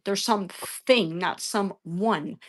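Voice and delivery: very slow, punching "thing" and "one"; emphatic contrast. Real, not acted. Arguing with vegan chat, slow emphatic contrast on "one". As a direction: lean hard on "some thing" versus "some one". Spells out the distinction slowly.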